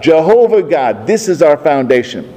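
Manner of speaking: normal talking voice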